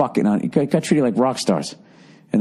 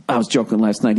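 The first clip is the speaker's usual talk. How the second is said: ordinary talk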